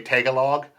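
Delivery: Tagalog as TAG-a-log, stress on the first syllable; common mispronunciation. Shown as a demonstration. Showing how people misread Tagalog, said TAG-a-log. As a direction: say Tagalog wrong, hitting TAG at the front. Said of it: "a lot of people say it wrong".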